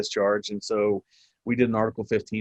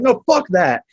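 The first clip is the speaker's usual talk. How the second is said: loud, raised-pitch refusal; defiant outburst at high volume; angry yelling; 9 semitones higher, 9 dB louder, slower than usual. Retold or imitated. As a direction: yell back, cutting him off. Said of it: "I'm, like, yelling".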